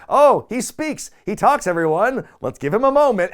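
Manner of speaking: host taunting silent Jericho to the crowd, loud mock; mocking announcement. [name] sarcastically presents Jericho, booming mock.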